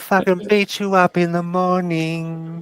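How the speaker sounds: mock lullaby in a lightly voiced Aussie accent, slow at the start; Australian lullaby. Rough Aussie dad tucking his mate in, mock lullaby. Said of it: "Australian lullaby".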